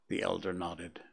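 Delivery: plain reading voice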